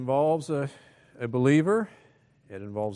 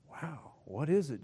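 ordinary talk

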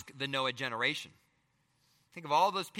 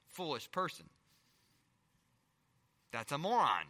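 ordinary talk, nothing marked

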